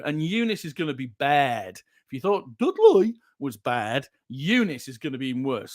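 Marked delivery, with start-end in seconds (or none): 2.6-3.2 brief comic Brummie twist; West Midlands accent. Pretend. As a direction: slip one word into West Midlands. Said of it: "a West Midlands thing".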